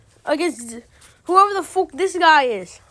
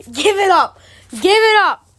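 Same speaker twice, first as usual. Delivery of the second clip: louder, rising exasperated yell; exasperated shout; 5 semitones higher, 5 dB louder than usual. Real, not acted. Fan fed up with the card, yelling give it up. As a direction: shout it in disbelief.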